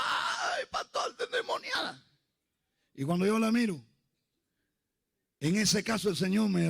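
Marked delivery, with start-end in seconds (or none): 0.0-2.1 very high, thin mock wail; imitated crying scream. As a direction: wail to the pastor like a panicked girl. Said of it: "Y ella gritaba y lloraba".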